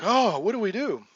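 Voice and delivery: high, loud, hammed-up alarm; mock panic. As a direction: imitate a flustered party at a loss. Imitates party panicking at charging figure.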